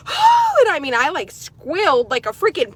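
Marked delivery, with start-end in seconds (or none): 0.0-0.6 high loud startled oh; retold gasp. Startled by the slip, high startled yelp. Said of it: "I was like".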